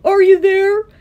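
high, loud searching call. A narrator's reading. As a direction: call out for lost friends. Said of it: "and called".

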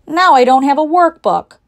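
loud, high-pitched grumble; complaining. A narrator's reading. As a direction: complain loudly like a put-out schoolchild. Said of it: "complained Joy".

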